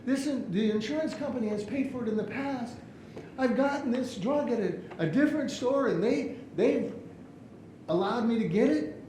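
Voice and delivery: high-pitched indignant protest; upset customer. Acted. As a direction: argue back as an upset customer. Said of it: "he is very upset".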